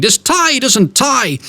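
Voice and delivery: high, slow complaint about the tie; a fussing dad who can't manage his tie; frustrated father. Retold or imitated. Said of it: "Mister Darling comes onto the stage".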